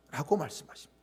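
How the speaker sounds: ordinary talk, nothing marked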